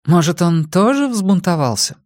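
high feminine character voice, tentative question; woman suggesting. A narrator's reading. Woman guessing about Rex's son, high character voice. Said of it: "предположила женщина".

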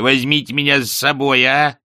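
pleading. A narrator's reading. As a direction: beg to be taken along. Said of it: "взмолилась статуя".

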